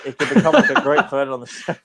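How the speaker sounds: words broken by laughter; laughing. Real, not acted. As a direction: talk while cracking up.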